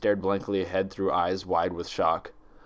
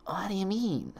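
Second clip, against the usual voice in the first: breathless, whispered stammer, much higher; frightened croak; 11 semitones higher, 5 dB quieter than usual. A narrator's reading. Twilight, terrified, whispering a question to the mare. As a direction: barely get the words out through a dry throat. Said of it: "Twilight croaked".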